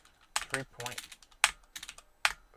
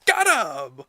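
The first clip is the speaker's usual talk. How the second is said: sudden loud cheer, pitched up; real burst of joy at the strike call; excited shout; 6 semitones higher, 13 dB louder than usual. Real, not acted. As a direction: yell it like the umpire just rang him up.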